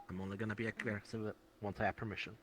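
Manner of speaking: ordinary talk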